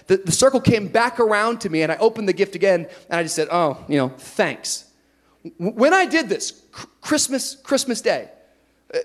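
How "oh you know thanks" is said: low, fading sarcastic "thanks". Retold or imitated. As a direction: mumble it out sarcastically. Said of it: "with a sarcastic tone".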